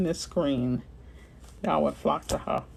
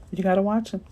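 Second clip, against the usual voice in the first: ordinary talk, nothing marked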